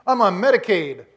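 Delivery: loud mock protest of an old man; comic refusal. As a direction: ham up a stubborn old man saying no. Abraham refusing God, comic.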